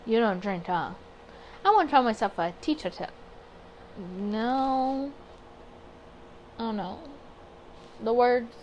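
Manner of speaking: ordinary talk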